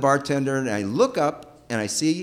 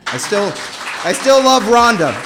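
louder and higher, real emotion breaking through; heartfelt outburst; 6 semitones higher, 8 dB louder than usual. Real, not acted. Teller ends the story crying out his lasting love, loud and higher. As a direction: declare it loud, still in love after all these years.

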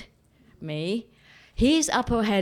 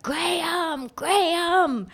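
high, drawn-out call to someone ahead; mock yell, pitched high without full volume; 10 semitones higher, much slower than usual. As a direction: call after him in a high, plaintive voice. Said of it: "I yelled out to him".

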